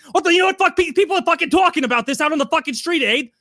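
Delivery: high, loud hammed-up furious rant; mock rant. Chrissy yells at his fiancee, hammed-up high angry rant. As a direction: rant like an outraged thug. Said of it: "and he's like".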